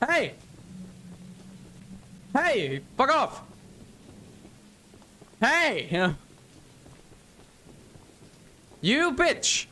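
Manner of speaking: high, frantic yells during a fight; yelling in panic. Real, not acted. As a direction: shout at the attackers in alarm. Fighting enemies in game.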